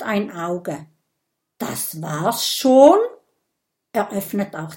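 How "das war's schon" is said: slow, rising disbelief; surprised relief. Acted. Dragon after the prick, slowly asking in surprise. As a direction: ask it slowly, hardly believing it.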